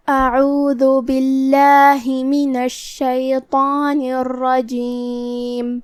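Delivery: slow, drawn-out melodic recitation; Quranic recitation. Shown as a demonstration. Teacher reciting the isti'adha before the lesson. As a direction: chant it slowly like tajweed recitation.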